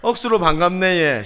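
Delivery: loud, hearty Gyeongsang hello; Gyeongsang dialect greeting. Shown as a demonstration. Pastor models a greeting for neighbors, loud Gyeongsang accent. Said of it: "경상도 말로 인사합시다".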